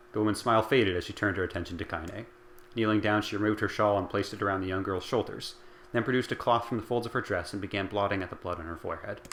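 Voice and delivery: plain reading voice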